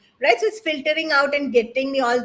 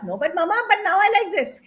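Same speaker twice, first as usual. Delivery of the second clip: put-on quick, higher, light kid voice; child's voice; 6 semitones higher, faster than usual. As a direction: answer like a breezy kid. Son answering mother in story.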